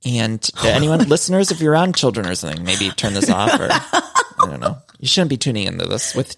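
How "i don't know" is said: laughing. Real, not acted. Laughing at own warning.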